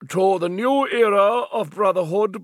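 high, loud official toasting; formal toast. Acted. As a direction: raise a glass, grandly. President proposes a toast.

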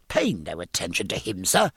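ordinary delivery

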